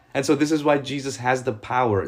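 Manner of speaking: ordinary talk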